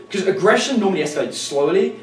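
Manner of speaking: normal talking voice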